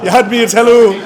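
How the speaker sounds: bursting into high loud laughter; real laughter breaking through; laughing. Real, not acted. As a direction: crack up while saying it. Laughing at Alan's line.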